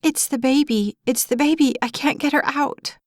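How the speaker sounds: high, panicked mother's cry; a frightened young mother begging for help, high and panicky; near hysteria. Acted. Mother to firefighters about her trapped baby, high panicked voice. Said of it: "a frightened young woman barely more than twenty stood there in near hysteria".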